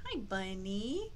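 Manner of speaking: normal talking voice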